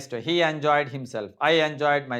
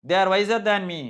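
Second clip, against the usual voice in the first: emphatic wrong example; 5 semitones higher, 6 dB louder, slower than usual. Shown as a demonstration. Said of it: "మీ కాదు".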